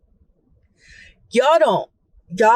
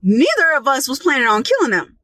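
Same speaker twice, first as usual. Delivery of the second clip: ordinary talk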